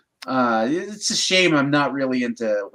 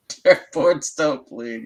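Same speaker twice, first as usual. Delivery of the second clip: laughing punchline; 4 semitones higher, 6 dB louder, slower than usual. Real, not acted. Punchline of dark joke.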